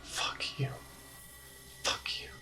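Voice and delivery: whispered, barely audible fuck you; a captive whispering defiance; muttered curse. Acted. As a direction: hiss it under your breath at him. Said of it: "starting to mutter".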